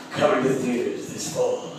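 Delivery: ordinary delivery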